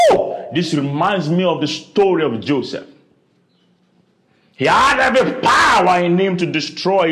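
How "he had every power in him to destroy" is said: loud, emphatic declaration; preaching shout. Real, not acted. Preacher telling Joseph's story with a loud declaration. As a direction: proclaim it with force.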